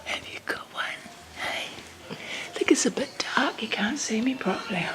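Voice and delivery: soft hushed whisper. Real, not acted. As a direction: whisper gently in the dark. Whispering to a drowsy baby.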